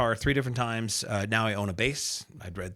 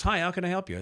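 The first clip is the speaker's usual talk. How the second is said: chirpy receptionist; 9 semitones higher, faster than usual. Retold or imitated. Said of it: "the receptionist".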